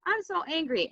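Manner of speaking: gentle pretend anger voiced as a child; mock angry kid. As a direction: play an upset kid, not too hard. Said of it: "So you could be like".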